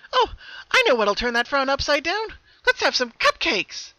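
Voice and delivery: very high and loud, perky; happy Pinkie nicknaming her double, sing-song. Acted. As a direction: chirp it with cheery cruelty. Happy twin offers cupcakes.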